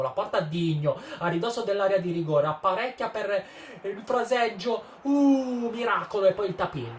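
building from loud to a high yell; breathless italian goal commentary. Real, not acted. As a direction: climb into the goal call.

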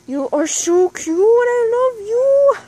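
high, loud, slow cooing. Real, not acted. Cooing at her pet rabbit.

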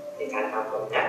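normal talking voice

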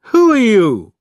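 put-on loud, high drunken question; drunk man. A narrator's reading. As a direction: blurt it out loudly, drunk and confused. Drunk Larry addresses his mother.

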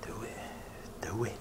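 urging whisper. Real, not acted. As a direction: whisper it like a dare. Egging friend on quietly.

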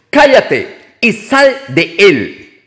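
a firm rebuke dragged out word by word; stern rebuke. A narrator's reading. Jesus rebuking the unclean spirit, slow and stern. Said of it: "Jesús le reprendió".

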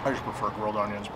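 chewing between words; talking through a bite; mouth full of burger. Real, not acted. Seen 2: Bit burger at -4; cheeks and lips working while he talks.

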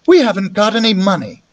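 put-on nervous boy. A narrator's reading. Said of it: "I said".

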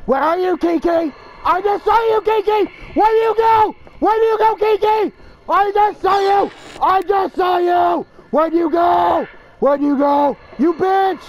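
high, loud searching calls; calling out. Acted. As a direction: yell across the map for her. Calling out for hiding Kiki.

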